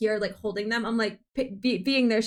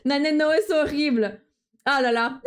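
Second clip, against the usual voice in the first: loud, higher mock French protest; mock French dismay; 4 semitones higher, 3 dB louder than usual. Streamer hamming up French outrage to chat.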